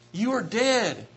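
ordinary talk, nothing marked

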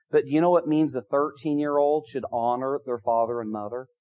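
ordinary delivery

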